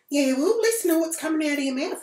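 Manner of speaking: high, snippy retort from the woman on the bus; mimicked snippy woman.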